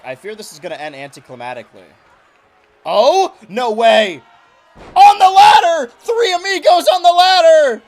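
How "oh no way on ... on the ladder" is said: excited shouting. Acted. Commentator erupts at a ladder spot.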